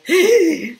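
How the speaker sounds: sharp loud gasp of alarm; panicked gasp. Retold or imitated. Imitating the body's fight-or-flight alarm with a gasp. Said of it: "fight or flight intensity of, like".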